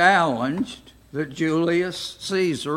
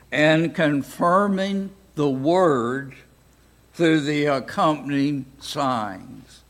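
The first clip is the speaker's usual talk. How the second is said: scripture reading. Acted. Teacher reads Mark sixteen to the class, reading voice. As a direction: read the verses out steadily to the class.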